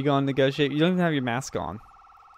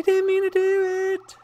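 very high, slow pleading kitten voice; high kitty voice; 3 dB louder, much slower than usual. Pretend. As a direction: squeak out a tiny apology.

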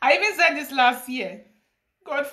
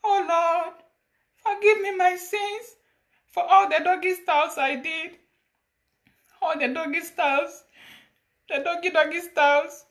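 high, plaintive plea for forgiveness; pleading confession; 5 semitones higher than usual. Acted. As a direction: confess to the Lord in a raised, imploring voice. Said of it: "I am coming to confess my sins".

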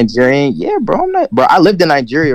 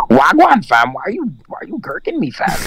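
imitating Toronto slang voice; light Toronto mandem accent, higher pitch; 4 semitones higher than usual. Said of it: "top five funniest accents ever".

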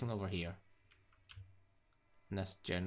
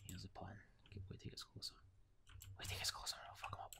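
hushed whisper, barely voiced; 12 dB quieter, faster than usual. Real, not acted. Gamer plotting an ambush on a nearby enemy, whispering. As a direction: plan the ambush in a breath.

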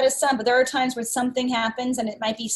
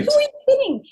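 mock incredulous; 13 semitones higher, 3 dB louder, faster than usual. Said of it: "It's more just like".

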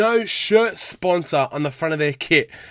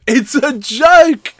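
higher, louder, slow disbelief; exasperated outburst; 7 semitones higher, 7 dB louder, slower than usual. Real, not acted. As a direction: spit out "It's a joke." slowly and loudly in disbelief. Ranting at the award.

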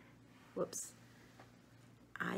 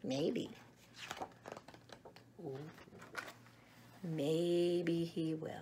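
put-on reading to children; 8 dB louder than usual. A narrator's reading. Reads picture book aloud.

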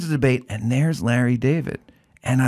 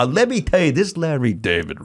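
Howard impression; 3 semitones higher than usual. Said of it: "Could you do your Howard for us?".

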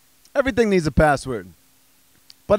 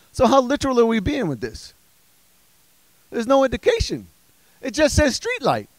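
higher-pitched baffled complaint; exasperated; 6 semitones higher than usual. Acted. Comic puzzling over street light captcha, exasperated. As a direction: plead against the vague instructions.

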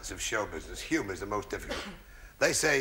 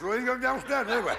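higher, mock mother telling the child. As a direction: shoo the kid off as mum. Mother voiced in the joke.

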